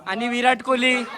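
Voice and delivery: high, fast mock cricket commentator hype; excited commentary. Vlogger hyping the family cricket game to viewers, excited commentary. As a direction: call the backyard match like a live TV match.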